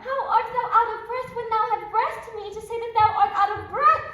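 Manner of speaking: high, loud laughing disbelief; laughing mockery. Juliet laughing at the nurse's breathless excuse, high and loud. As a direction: tease her through open laughter.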